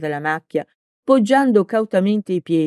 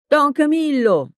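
shouted name; 9 semitones higher than usual. A narrator's reading. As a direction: yell his name across the field. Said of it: "urlò ancora Peppone".

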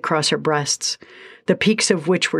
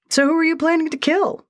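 much higher, offhand sprite voice; casual sprite voice; 12 semitones higher than usual. A narrator's reading. Sprite Egan casually asking Lindsay about murder. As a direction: toss the question off lightly. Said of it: "Egan asked casually".

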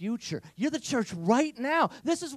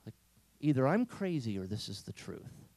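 ordinary talk

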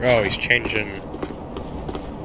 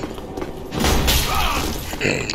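clearing the throat; a real throat clear; 13 semitones higher, 5 dB quieter than usual. Real, not acted. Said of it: "throat clearing".

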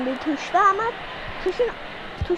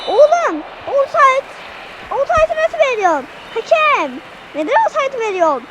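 furious protest; 5 semitones higher than usual. Real, not acted. Gamer yelling at the referee over offside, angry. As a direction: rage at the referee's call.

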